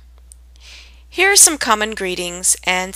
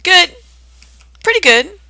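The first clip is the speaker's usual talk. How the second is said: bright casual reply; 12 semitones higher, 7 dB louder than usual. Shown as a demonstration. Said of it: "you could respond".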